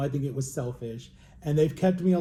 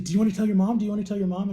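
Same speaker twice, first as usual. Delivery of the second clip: bright, higher, louder teacher voice asking eagerly; a lightly voiced eager teacher; cheerful teacher; 5 semitones higher, 5 dB louder, much faster than usual. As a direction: play the excited teacher checking in. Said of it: "And she's like".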